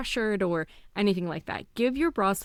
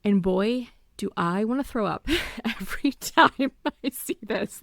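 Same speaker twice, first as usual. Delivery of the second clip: usual speaking voice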